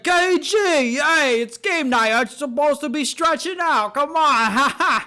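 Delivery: high, slow whiny voice, ending in a laugh; a hammed-up whine, begging the coach, breaking into a laugh; mock a sad-sack guy pleading about game night; Adam Sandler impression. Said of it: "And he talks like this".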